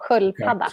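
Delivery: "Sköldpadda" said clearly, native Swedish; Swedish model word. Shown as a demonstration. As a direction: correct it: "Sköldpadda", no N. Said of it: "No N, no".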